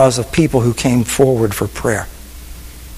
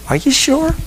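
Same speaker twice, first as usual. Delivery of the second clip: incredulous question; 12 semitones higher than usual. Retold or imitated. Pastor questioning the Spirit.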